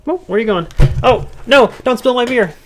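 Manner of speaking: high, loud cutesy scolding of a cat; alarmed pet-talk. Real, not acted. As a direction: squeal a warning at a pet. Cat about to spill his beer.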